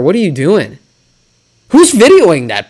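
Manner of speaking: ordinary talk, nothing marked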